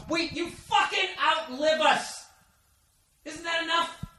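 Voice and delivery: high, slow, soft whiny complaint; mock whiny retort. Boyfriend arguing with girlfriend, high soft whiny mock. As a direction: whimper it like a put-upon guy. Argues back in put-on voice.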